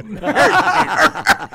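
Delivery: loud high laughter. Real, not acted. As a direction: crack up loudly. Laughing at the joke. (gargalhada alta)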